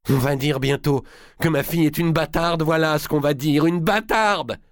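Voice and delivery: high, loud bitter cry; anguished outcry. A narrator's reading. Louis cries out about his daughter, a high anguished outburst. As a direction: spit out bâtarde in anguish. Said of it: "il s'écria".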